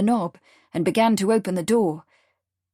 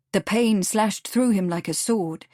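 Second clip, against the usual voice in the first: ordinary delivery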